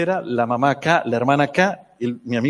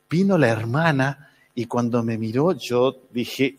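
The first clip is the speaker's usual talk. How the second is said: ordinary talk, nothing marked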